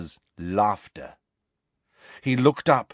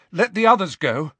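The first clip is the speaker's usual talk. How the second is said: higher, urgent plea; desperate; 7 semitones higher, 4 dB louder, faster than usual. A narrator's reading. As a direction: plead desperately, pitch raised. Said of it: "said Harry desperately".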